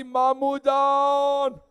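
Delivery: high, slow wailing plea, breaking; wailing cry for help. Acted. Fallen boy calling his uncle for help, slow high wail. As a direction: cry out to the uncle, drawn out and grieving. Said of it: "एक ने आवाज दी".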